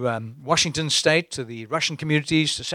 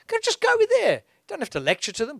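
much higher, eager urging; 11 semitones higher, faster than usual. Real, not acted. Preacher urges older listeners to go, eager urging. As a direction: push them out the door.

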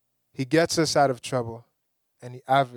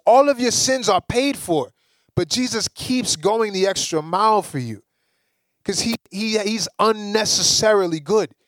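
higher, louder preaching build; full-force preaching; 5 semitones higher, 3 dB louder than usual. Acted. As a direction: preach it out with rising fire.

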